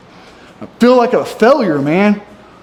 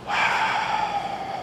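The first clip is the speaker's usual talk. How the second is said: long breathy sigh; weary sigh; 13 dB quieter than usual. Real, not acted. Stuck on his diet plan, a weary sigh. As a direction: let out a tired sigh.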